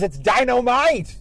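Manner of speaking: big, loud shouted catchphrase, pitch climbing; Jimmy Walker catchphrase. Retold or imitated. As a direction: belt it out like a sitcom catchphrase. Mimics Jimmy Walker's famous catchphrase.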